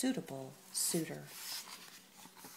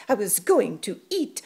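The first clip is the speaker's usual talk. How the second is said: hissed; 9 dB louder than usual. Acted. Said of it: "he hissed".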